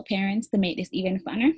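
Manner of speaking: usual speaking voice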